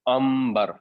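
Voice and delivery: امبر said with a clear m before b; n becomes m. Shown as a demonstration. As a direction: press the lips: امبر with m, not n. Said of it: "یہ امبر کی آواز نکلے گی".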